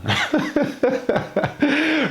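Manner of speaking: real laugh, going up high; high burst of laughter. Real, not acted. Laughs at the road man label.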